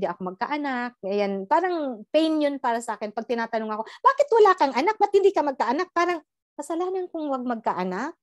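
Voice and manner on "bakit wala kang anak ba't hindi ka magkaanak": higher and louder, prying demand; mock the pushy askers; nagging questions. People badgering her about having no child, in a louder, higher nagging voice.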